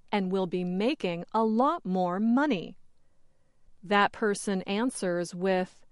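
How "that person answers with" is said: normal talking voice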